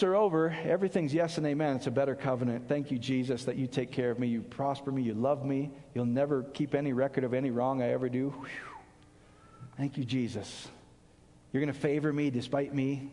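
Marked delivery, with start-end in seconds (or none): 2.7-13.1 grateful prayer. Real, not acted. Pastor thanking Jesus softly.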